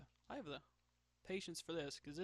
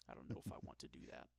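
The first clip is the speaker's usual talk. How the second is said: muttered aside; 13 semitones lower, 7 dB quieter, faster than usual. Real, not acted. Muttering to herself while drawing.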